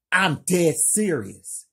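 high, loud frightened insistence; insist, still shaking with fright; scared. Acted. Kelly convincing Justin, high loud frightened insistence.

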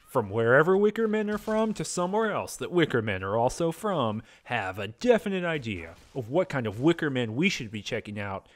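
loud hyped video host; YouTuber voice. Shown as a demonstration. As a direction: pump up the audience like a YouTuber. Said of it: "let me get my, uh, YouTuber voice on".

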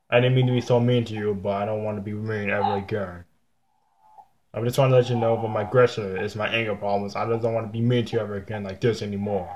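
soft, low, hurried apology; quiet apology. Real, not acted. Player apologising for their anger problems, quiet and subdued.

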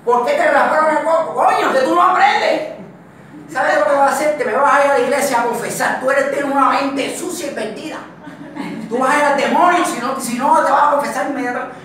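acted angry mom lecture; scolding mother. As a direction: lay down the law to a sinful son. Mother scolds son, orders confession.

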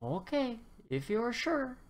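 high, doubtful leprechaun voice. Acted. Leprechaun grants the gold wish, high and doubtful.

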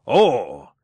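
loud, sudden exclamation; a loud "oh" of recognition; quite loud. A narrator's reading. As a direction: let it burst out loudly. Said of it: "said he quite loud".

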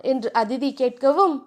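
plain reading voice

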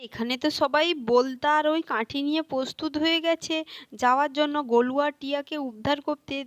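ordinary delivery

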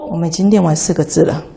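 ordinary talk

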